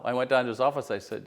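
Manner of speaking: ordinary delivery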